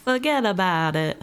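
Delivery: amateur mock New York accent. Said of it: "I obviously can't do that accent".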